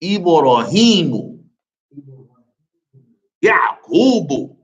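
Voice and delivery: slow teacher's model, each long vowel held; slow repeat-after-me model. Shown as a demonstration. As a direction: draw out Ibrahimu and Yaqubu for the class. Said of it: "after me, class".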